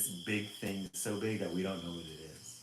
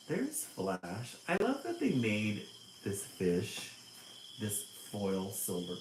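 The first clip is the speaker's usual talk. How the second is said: usual speaking voice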